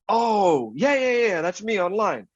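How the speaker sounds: high drawn-out oh, then eager yeses; dawning realization. Retold or imitated. Retelling himself catching on to the stranger, high and eager. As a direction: act out the penny dropping, pitched high.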